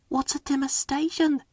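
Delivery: high, soft little engine voice; quiet Percy. A narrator's reading. Small engine quietly asking the others, high and soft. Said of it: "asked Percy quietly".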